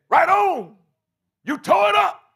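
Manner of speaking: high, loud, cocky cheer; voice of the flesh. Acted. Preacher voicing his flesh cheering him on, high and loud. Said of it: "And my flesh saying".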